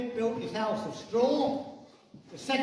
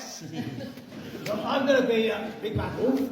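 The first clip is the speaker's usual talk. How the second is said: usual speaking voice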